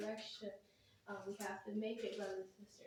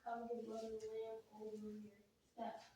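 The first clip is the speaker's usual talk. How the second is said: ordinary talk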